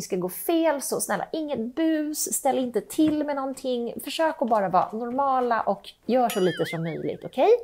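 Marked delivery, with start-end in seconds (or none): none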